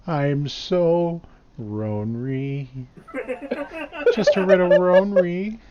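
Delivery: high, slow, sad ronry lament; Kim Jong-il accent. Retold or imitated. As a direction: sing it like Kim Jong-il in Team America. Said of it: "Why do you give me that accent?".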